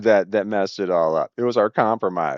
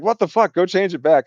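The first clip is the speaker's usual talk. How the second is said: high exasperated snap, boss demanding it back; angry retold outburst; 6 semitones higher than usual. Furious boss ordering the change undone, an exasperated snap. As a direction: fire it off like a fed-up boss.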